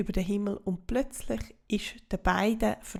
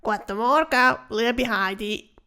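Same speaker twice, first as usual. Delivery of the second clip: louder, grunting critter voice, slowing down; grunting cockroach; 6 dB louder than usual. Acted. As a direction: grunt the greeting like a sly bug. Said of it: "winkt und grunzt".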